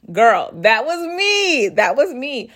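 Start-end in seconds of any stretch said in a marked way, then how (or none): none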